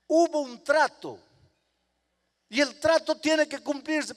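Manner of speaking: high, loud and unbending; stern mother. Acted. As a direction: lay down the law to your son. Mother insists on the deal.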